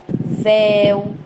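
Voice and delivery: "véu" held long on open é; very slow demonstrated "véu"; open diphthong. Shown as a demonstration. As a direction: stretch "véu" slowly from open é to u. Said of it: "ditongo aberto também eu".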